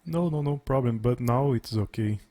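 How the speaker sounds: usual speaking voice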